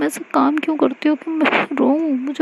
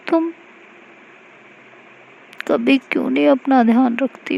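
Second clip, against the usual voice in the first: crying while talking; 4 semitones higher than usual. Acted. Mahi to hurt Aashi, tearful reproach. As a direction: scold her through tears, mildly. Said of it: "रोने लगती है और कहती है".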